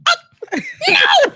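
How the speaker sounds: horrified squeal of refusal; shocked no. Real, not acted. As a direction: recoil in disbelief at the food. Shocked at butter in potatoes.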